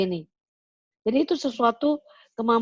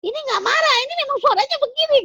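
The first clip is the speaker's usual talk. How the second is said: much higher, louder, fast insistence; booming protest; 4 dB louder, faster than usual. Retold or imitated. Sumatran speaker to Javanese colleagues, loud high protest. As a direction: protest loudly that this is just your voice. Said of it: "kalau suara kegedean".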